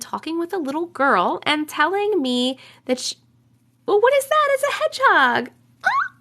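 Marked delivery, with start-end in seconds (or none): 3.9-5.5 sudden high, loud delighted squeal; light up with real surprise at a cute hedgehog; excited squeal. Real, not acted. 5.8-6.1 delighted coo. Real, not acted. Cooing at hedgehog picture.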